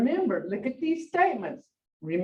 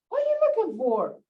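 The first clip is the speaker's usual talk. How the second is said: higher, fast exasperated ask; aggravated question; 10 semitones higher, faster than usual. Retold or imitated. Wife fed up with husband's trips, aggravated high question. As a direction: fire it off, fed up. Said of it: "you get aggravated".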